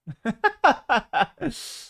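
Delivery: a high, far-off burst of disbelieving laughter; incredulous laugh. Real, not acted. Laughing at chat's American fast food pick. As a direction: laugh at the suggestion of McDonald's.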